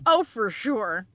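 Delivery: slow, drawn-out ironic "for sure"; sarcastic. Pretend. Player mocking a bad choice, slow and sarcastic. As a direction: agree with heavy irony.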